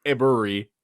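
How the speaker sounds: ordinary talk, nothing marked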